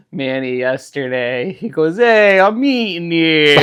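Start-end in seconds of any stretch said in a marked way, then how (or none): 2.0-3.6 higher, louder New York Italian quip; son's proud imitation, punchy; son's New York accent. Dad quoting son at dinner, loud New York Italian. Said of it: "he did it really well".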